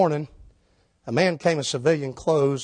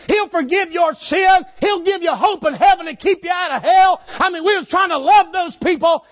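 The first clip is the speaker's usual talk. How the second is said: high loud shouted preaching; preaching at full force; 13 semitones higher, 6 dB louder than usual. Acted. Street preacher telling the crowd Jesus loves them, shouting. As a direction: drive it home like a street preacher.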